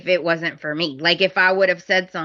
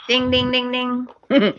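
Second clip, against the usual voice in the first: sung higher ding ding; bell ding; 5 semitones higher than usual. Pretend. Reacting to Ridge's line, a playful ding. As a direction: ring it like a bell. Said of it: "I was like".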